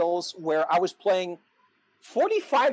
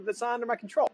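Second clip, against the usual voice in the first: ordinary talk, nothing marked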